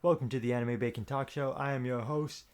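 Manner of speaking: normal talking voice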